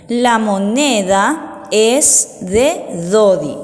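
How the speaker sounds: slow, word by word; reading aloud. Shown as a demonstration. Teacher reads the first sentence to children, slow and separated. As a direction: read it out slowly with clear gaps. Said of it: "Vamos a leer la primera oración".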